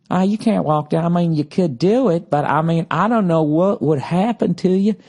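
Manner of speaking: lilting, exaggerated Southern accent. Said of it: "I just like the Southern accent".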